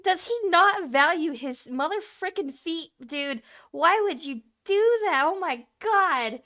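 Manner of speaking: high, slow, drawn-out disbelief; appalled exasperation. Real, not acted. Viewer appalled at builder risking his feet, exasperated.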